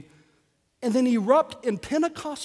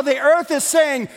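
normal talking voice

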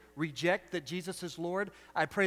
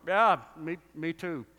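loud mock-macho agreement, easing off; feigned manliness; 7 dB louder, slower than usual. Pretending to brother-in-law he builds things too, put-on manly. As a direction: play along like you know engines. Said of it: "but I pretend".

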